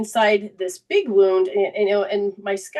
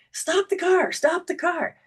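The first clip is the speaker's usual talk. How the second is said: high, urgent mock cry to stop; urgent shout; 10 semitones higher than usual. Siblings yelling at the driving brother, high mock urgency. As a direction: call out to the driver in mock alarm. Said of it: "They're like".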